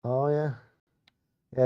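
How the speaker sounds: ordinary delivery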